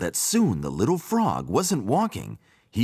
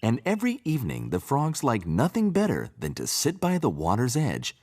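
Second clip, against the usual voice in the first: ordinary delivery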